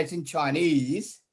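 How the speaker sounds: ordinary delivery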